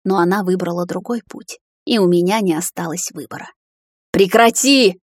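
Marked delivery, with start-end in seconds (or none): 4.1-5.0 loud, high command; raised, furious order; angry bark. A narrator's reading. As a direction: cut them off with a shout. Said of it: "рявкнула она".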